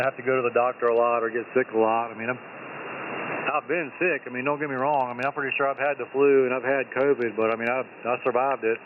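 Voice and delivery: ordinary talk, nothing marked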